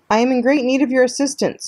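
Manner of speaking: high, friendly appeal; cheerful robot. A narrator's reading. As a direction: ask for help far too cheerfully. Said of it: "why does your voice sound so cheerful".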